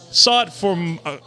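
ordinary talk, nothing marked

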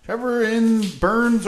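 loud, slow, drawn-out announcement; announcer emphasis. Acted. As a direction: announce the name with flair. Announcing listener's name.

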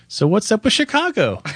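high, singsong take on WITH CHICAGO; mock-chirpy interviewer re-reading a question; varied question inflection. Host re-recording his interview questions, exaggerated high inflection. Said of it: "tried to change the inflection every time".

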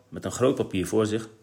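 ordinary delivery

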